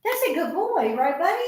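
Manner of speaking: high sing-song praise for the dog; pet talk. Real, not acted. Owner praising her dog Kimber in a high cooing voice. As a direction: coo it up high like talking to a puppy.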